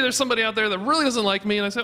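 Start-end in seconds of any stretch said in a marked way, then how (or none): none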